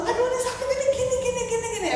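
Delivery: high, soft anxious fussing; fretful mother. Retold or imitated. Mother recalling her baby's scrapes, fretting. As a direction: fuss over a hurt baby in a high voice. Imitates her past panic over baby.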